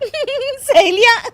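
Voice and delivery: high, drawn-out giggling question; laughing echo. Acted. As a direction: repeat it incredulously through giggles. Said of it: "तुम फिर से हंस रही हो".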